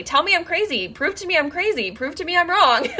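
high, half-laughing retold plea; insistent plea. Retelling her plea to be disproved.

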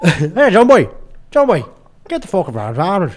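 high, loud Irish calls settling into gabble; mock Irish traveller. Imitating an Irish traveller, loud mock accent. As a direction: call out in a hammed-up Irish accent. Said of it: "That's a good accent".

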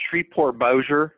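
ordinary delivery

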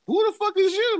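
high, loud mock-shocked demand; freaked out. Man demands who the strange woman is, freaked out. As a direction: retell it as an alarmed squawk. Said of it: "I freaked out".

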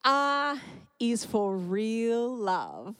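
slow, halting and moved; getting teary. Real, not acted. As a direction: hold back tears, very slowly. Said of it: "I'm probably gonna get teary".

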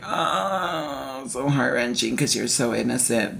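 soft, cooing sympathy; tender aww. Retold or imitated. Reacting to the innocent character's sweet line, tender and soft. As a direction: melt quietly over how sweet he is.

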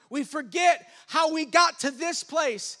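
emphatic preaching. Real, not acted.

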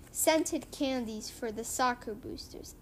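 ordinary delivery, nothing marked

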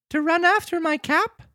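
put-on squeaky small boy answering the police; puppet boy. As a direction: Pinocchio answers the officers in a high boy voice. Pinocchio voice.

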